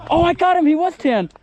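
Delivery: high, loud burst of triumph; thrilled shout after a hit; excited. Real, not acted. Just scored a hit.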